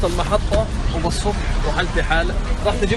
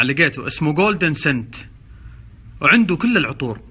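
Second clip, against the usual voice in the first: ordinary delivery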